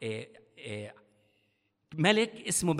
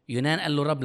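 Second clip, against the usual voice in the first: ordinary talk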